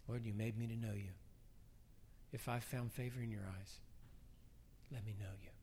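softening, low and intimate; quiet prayer. Acted. Preacher showing listeners what to pray, hushed prayer. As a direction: pray it gently, fading almost to a whisper.

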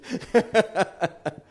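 real laugh after the punchline; hearty laugh. Real, not acted. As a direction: laugh out at the joke.

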